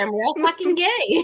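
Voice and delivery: loud triumphant punchline. Real, not acted. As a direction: shout it out with glee.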